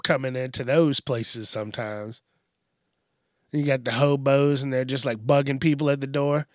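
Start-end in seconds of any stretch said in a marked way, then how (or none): none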